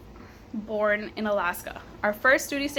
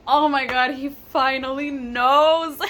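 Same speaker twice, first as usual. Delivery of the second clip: louder, slower, overwhelmed relief; emotional relief; 3 semitones higher, 6 dB louder, slower than usual. Retold or imitated. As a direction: let the relief swell as the call finally comes. Sees husband's number, starts crying.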